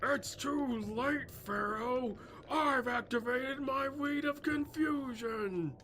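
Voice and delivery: very high, drawn-out villain declaration; hammed-up anime villain, slow and high; Merc impression. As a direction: announce your card like a theatrical cartoon duelist. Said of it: "be Merc".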